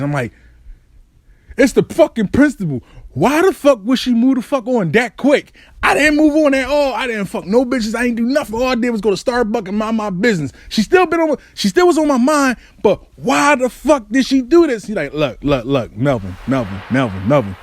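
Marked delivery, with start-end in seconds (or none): 1.6-14.9 high, worked-up tirade; retold raging rant. Ranting to a friend about the breakup, retold rage. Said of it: "So I'm raging". 15.1-17.6 low repeated interjection, mock patience; calming friend. Friend trying to stop Melvin's rant, low and calming.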